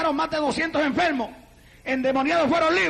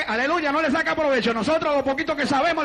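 usual speaking voice

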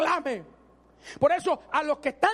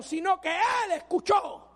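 normal talking voice

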